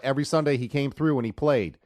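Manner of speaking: usual speaking voice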